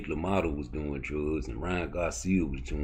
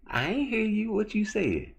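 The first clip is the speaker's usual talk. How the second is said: imitating a woman, much higher, mocking; Miss Parker voice. Telling his chat the story, voicing Miss Parker high and teasing. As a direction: pitch way up to play the teacher teasing him.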